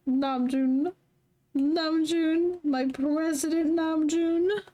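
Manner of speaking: high, drawn-out hail of the name; calling out. Acted. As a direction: call his name like a cheering fan. Calling out to president [name].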